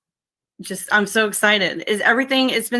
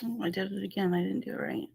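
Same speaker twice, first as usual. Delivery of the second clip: much softer, half to herself while busy; muttering to herself; 10 dB quieter than usual. Real, not acted. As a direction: mumble it aside, away from the mic. Fiddles with link while streaming.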